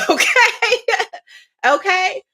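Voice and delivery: real laugh into a high okay. Real, not acted. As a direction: laugh it off, mocking. Laughs mocking the critic.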